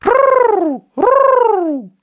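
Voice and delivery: high loud animal cry; shrill marmot call rolled out; marmot whistle call. Acted. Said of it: "Eddie s'écrie".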